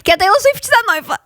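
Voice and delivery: high, loud excited announcement; excited squeal. Real, not acted. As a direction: blurt it out in delight. Announcing star's engagement.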